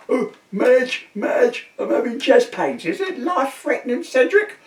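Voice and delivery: a frightened husband with chest pains calling to his wife, in a high comic panic; panicky old man. Retold or imitated. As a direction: squeal out in a comic flap.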